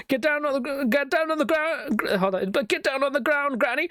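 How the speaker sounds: cartoon cop barking orders; raiding agent. Mock FBI raid on granny.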